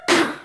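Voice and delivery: a sharp imitated tire screech, high and squealing. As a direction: mimic the skidding car with a short screech. Car skidding off the road.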